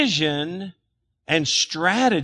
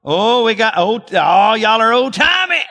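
loud teasing shout; 6 semitones higher, 6 dB louder than usual. Real, not acted.

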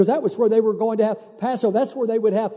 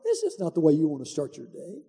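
softer, lower note to self; quiet self-talk; 5 semitones lower, 8 dB quieter than usual. Retold or imitated. Man deciding to turn off the news, quiet self-reproach. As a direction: tell yourself calmly to stop. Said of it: "I said to myself".

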